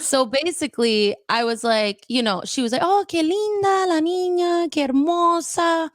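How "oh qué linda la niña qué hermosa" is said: put-on high, drawn-out cooing over a little girl; imitated gushing stranger; gushing admiration. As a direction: coo at someone's child. Stranger gushing over a child, retold.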